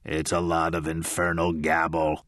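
belligerent gravelly baritone. A narrator's reading. Spirit voice mocking the audience, belligerent low growl. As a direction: sneer it like a cranky old codger. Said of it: "she said belligerently".

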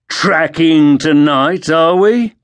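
high, slow, mocking soldier; sneering guard. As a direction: taunt him like a street thug. Poleaxe guard taunts.

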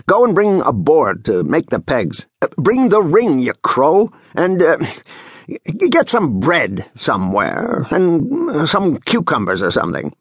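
fussy, excitable commands; bossy, irritable foreman. Acted. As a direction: order an errand, groping for the next item. Voices bossy Seryoshka giving orders.

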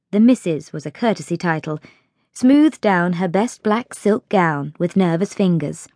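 plain reading voice

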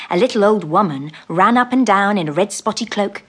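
usual speaking voice

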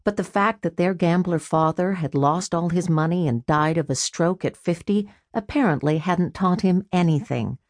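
usual speaking voice

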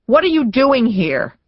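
loud, high-pitched demand. A narrator's reading. Woman to an unwelcome visitor through the door, demanding. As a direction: challenge the caller sharply. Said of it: "she demanded".

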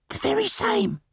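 very high, raspy bird whisper; whispering caterbird. Acted. Caged caterbird confirming who it is, high whisper. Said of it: "the caterbird whispered".

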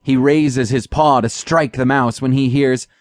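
ordinary delivery

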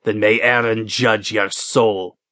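slow, heavy executioner voice; a touch of menace, measured and slow; executioner snapping. A narrator's reading. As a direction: executioner passing sentence, slow and grim. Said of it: "the executioner snapped".

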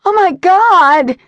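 high, loud and slow shriek of terror; piercing scream of horror; terrified scream. Acted. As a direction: scream it as she sees the monster. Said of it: "a scream ripped from her throat".

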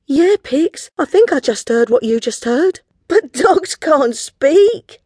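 put-on high, loud, incredulous girl; astonished. Girl answering her friend about the talking dog, astonished. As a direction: insist in amazement, stretching the end. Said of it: "looked at one another in astonishment".

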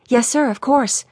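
timid secretary answering the boss; terrified. A narrator's reading. Terrified girl answers her boss's coffee request, mildly scared. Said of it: "The girl looked terrified".

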